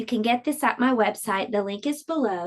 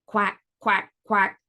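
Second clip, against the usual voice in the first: duck quack; 2 semitones lower, slower than usual. Acted. Duck answers the fox.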